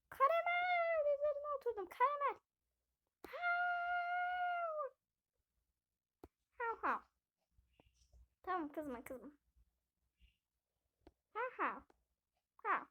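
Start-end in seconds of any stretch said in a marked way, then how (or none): 0.1-2.4 put-on much higher, squeaky little girl voice; high squeaky doll voice calling the dog; squeaky character voice. 3.3-4.9 very high yappy puppy bark; squeaky little bark; dog bark. Pretend. Dog character barks. 6.6-7.0 higher double yap; puppy barks. Pretend. Dog keeps barking. 11.3-12.9 higher yappy barks; little dog yapping back; puppy barks. Pretend. Dog keeps barking.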